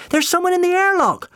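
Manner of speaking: very high, loud warning shout; alarmed cry. A narrator's reading. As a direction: yell the alarm at the top of the voice. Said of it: "Jim cried".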